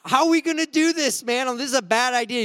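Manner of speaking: hammed-up fear of being crushed; mock panic. Youth under piano begging his pastor, mock panicked plea.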